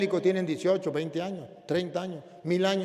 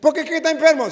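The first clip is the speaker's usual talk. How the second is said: much higher and louder woman's voice; insistent mother; 11 semitones higher, 12 dB louder, much faster than usual. Retold or imitated. The mother answers.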